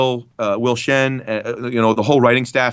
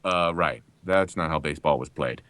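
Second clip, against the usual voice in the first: low, softer uncle's put-down; an East Coast uncle's attitude, pitched down; 9 semitones lower, 7 dB quieter than usual. Acted. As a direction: deliver it low, like somebody's uncle. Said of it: "I can hear uncles saying that kind of attitude".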